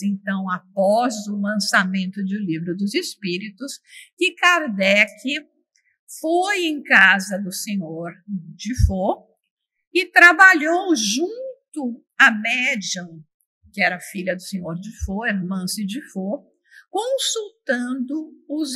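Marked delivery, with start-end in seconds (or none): none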